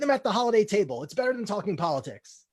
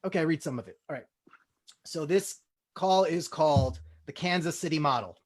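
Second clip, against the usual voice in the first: ordinary talk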